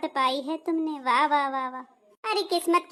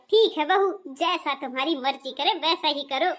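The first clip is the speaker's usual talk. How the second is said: usual speaking voice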